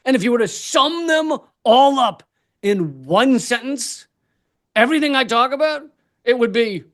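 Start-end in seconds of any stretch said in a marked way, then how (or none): none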